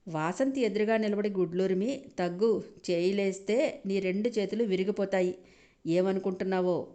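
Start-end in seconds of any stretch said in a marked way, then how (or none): none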